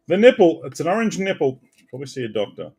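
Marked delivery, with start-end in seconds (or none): 0.1-0.6 excited. Real, not acted. Joking reaction.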